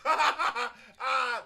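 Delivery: high hearty laugh from the back row; imitated laugh. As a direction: mimic a gleeful audience guffaw. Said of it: "someone in the back".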